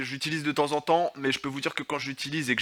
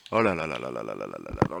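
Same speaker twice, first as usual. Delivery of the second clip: nervous babbling; 13 semitones lower than usual. Real, not acted. Panique chantonnée.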